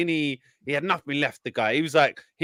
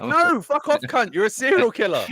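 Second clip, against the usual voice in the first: much higher, indignant voice snapping back; mock cop retort; 10 semitones higher than usual. Cops telling off the killer, mock indignant. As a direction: fire back like an outraged cop.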